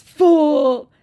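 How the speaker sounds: high, mocking put-on voice for the scammer; whiny high imitation of a chat scammer.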